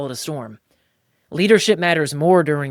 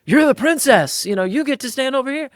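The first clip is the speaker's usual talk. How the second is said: mocking instructor; 11 semitones higher, 7 dB louder than usual.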